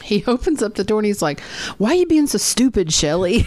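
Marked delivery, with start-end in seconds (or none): 1.8-3.4 hammed-up rebuke of a friend; mock scolding. Jeff opening the door on Shelley, mock scolding.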